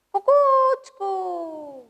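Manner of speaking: high, slow, stretched-out crow; rooster crow. Shown as a demonstration. Teacher leading kids in a rooster's crow. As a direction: crow कुकूचकू like a rooster, drawn out. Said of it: "चला सगळ्यांनी काढा बरं कोंबड्याचे आवाज".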